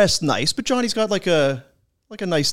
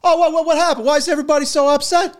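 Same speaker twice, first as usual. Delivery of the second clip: high, baffled innocent voice; hammed-up high bewilderment; oblivious teammate; 13 semitones higher, 3 dB louder, faster than usual. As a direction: imitate a clueless guy who missed everything. Clueless teammate asks, retold.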